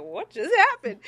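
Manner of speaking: stunned bewilderment. Retold or imitated. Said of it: "kinda stumbled off, like".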